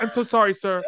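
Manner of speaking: high, loud flustered apology with laughter; imitating a flustered boss begging pardon; mortified apology. Manager apologises to the customer, mortified.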